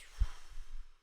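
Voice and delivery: loud breathy sigh out; big demo sigh. Shown as a demonstration. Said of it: "blow out any stress".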